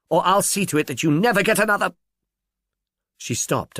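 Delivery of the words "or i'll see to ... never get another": higher and fast, cutting; sharp threat. Acted. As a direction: spit out the threat quickly. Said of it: "her voice sharp and purposive".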